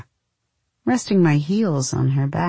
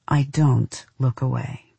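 usual speaking voice

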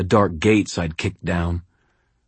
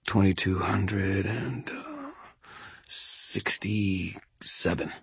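very slow, soft, halting; dazed counting; 2 semitones higher, 10 dB quieter, much slower than usual. A narrator's reading. Shaken wizard struggling to keep counting primes, dazed. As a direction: drag the number out quietly, searching for it.

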